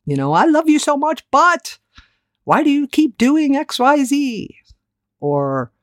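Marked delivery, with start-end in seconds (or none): 0.3-4.6 much higher, louder exasperated plea; pissy frustration at your husband; exasperated spouse. Retold or imitated. Wife snapping at husband, high and exasperated. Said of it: "we'll say things like that".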